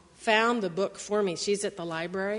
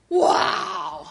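high loud delighted cry; excited wow; 6 semitones higher, 5 dB louder than usual. Acted. Reading to kids, a loud amazed wow. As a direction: gasp with wonder at the height.

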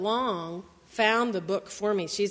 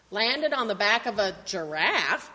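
ordinary delivery

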